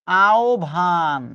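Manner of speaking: "আহ্বান" very slowly as ao-bhan; correct pronunciation, drawn out. Shown as a demonstration. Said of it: "এটার উচ্চারণ কি?".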